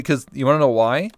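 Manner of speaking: ordinary talk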